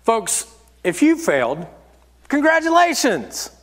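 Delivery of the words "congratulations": high, exclaimed congratulations; sudden upbeat, pitched-up cheer; celebratory. Real, not acted. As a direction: burst out congratulating them. Cheering listeners who have failed.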